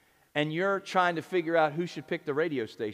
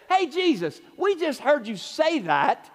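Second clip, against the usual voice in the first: eager disciples; 10 semitones higher, 4 dB louder than usual. Retold or imitated. James and John asking favor.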